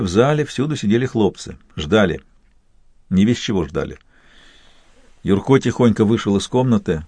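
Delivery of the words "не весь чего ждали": usual speaking voice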